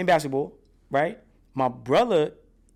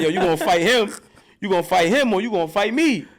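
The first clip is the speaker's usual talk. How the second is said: higher, louder, menacing aunt; aunt threatening; 6 semitones higher, 5 dB louder than usual. Retold or imitated. Aunt threatening the boy into fighting back, loud and fierce. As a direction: challenge him like a tough aunt. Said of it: "she was like".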